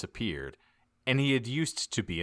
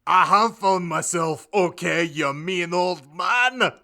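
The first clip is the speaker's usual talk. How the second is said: higher, louder indignant yell; Hagrid yelled; 10 semitones higher, 9 dB louder than usual. A narrator's reading. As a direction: shout at the old man. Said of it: "Hagrid yelled".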